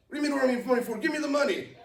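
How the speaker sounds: high, loud, pushy retold robber; mock robber demand. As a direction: bark it like a gunman shaking him down. Robber demanding money at gunpoint.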